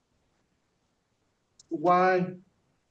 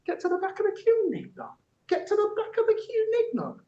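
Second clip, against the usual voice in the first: mocking high voice. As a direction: repeat the drunk's line in a much higher, squeaky mocking pitch. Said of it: "turns and he says".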